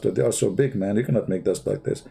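ordinary talk, nothing marked

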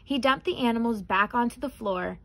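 ordinary delivery

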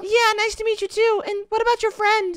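put-on high falsetto girly voice; man doing a woman's voice. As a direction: squeak it up high like a girl. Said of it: "Get your girl voices ready".

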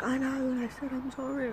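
lightly mocked contrite friend; mock meek apology. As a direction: imitate her sulky apology.

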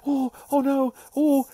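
put-on high, loud frightened girl's cry; a little girl panicking as a shadow looms; scared girl. Girl in bedroom sees mouse shadow, mock panicked squeal. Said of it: "the girl's in the bedroom going".

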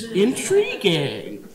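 drawn-out mock intrigue; very slow, knowing aside; mock fascination. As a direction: stretch it out slowly, pretending to be intrigued. Mock fascination at science titles.

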